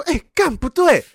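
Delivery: high-pitched retold outburst; sudden anger. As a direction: suddenly catch on and get mad. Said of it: "我就突然生气".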